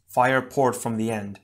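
ordinary delivery

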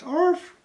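high yappy mock bark; dog bark. Answering a viewer about the dog's barks, a high mock bark.

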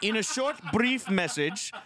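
put-on high-pitched Indian-accented automated prompt; robot phone agent. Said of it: "A robot".

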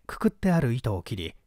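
usual speaking voice

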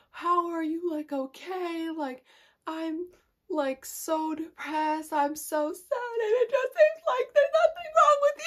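high, whiny mock sobbing; imitated crying. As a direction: ham up a tearful plea, building to a loud wail. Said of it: "I'm still just crying, sobbing about how hurt I am".

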